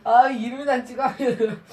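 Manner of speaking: laughing loudly through the words. Real, not acted. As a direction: laugh it out in frustration. Laughs at failing again.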